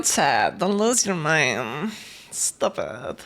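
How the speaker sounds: low mock scolding; mock deep voice. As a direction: play the stern deep-voiced bro. Teasing chat in put-on deep voice.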